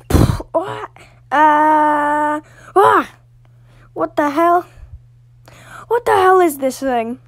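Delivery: high, loud startled yelps; panicked shock. Acted. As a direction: react to a monster leaping out. Frightened by a strange creature.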